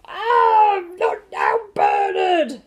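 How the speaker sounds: loud pained cry into dismissal; bitten dad. Acted. Dad, just bitten, yelps and waves Bernard away. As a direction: yelp then brush him off.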